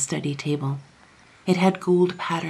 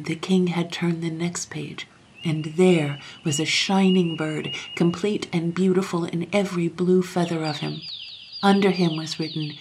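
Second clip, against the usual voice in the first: ordinary delivery, nothing marked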